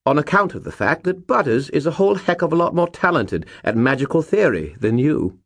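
chirpy know-it-all skull; cheerful Bob. A narrator's reading. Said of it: "Bob said cheerfully".

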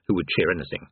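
ordinary delivery

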